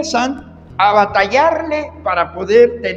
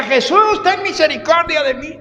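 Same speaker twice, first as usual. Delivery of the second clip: blind man's plea; 8 semitones higher than usual. Retold or imitated. Blind man calls to Jesus passing by, high imitated plea. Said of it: "aquel ciego que decía".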